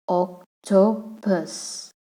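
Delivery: "octopus" slowly in three separate syllables; broken into syllables. Shown as a demonstration. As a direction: leave a gap between each syllable of "octopus". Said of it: "example for three syllable is".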